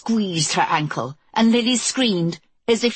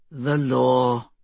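low sardonic repeat; 6 semitones lower than usual. Acted. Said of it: "Jonathan repeated".

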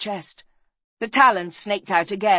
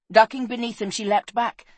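plain reading voice

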